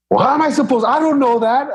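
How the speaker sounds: high mocking imitation of him; mimicking husband. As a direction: whine it back high like him. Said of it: "I go".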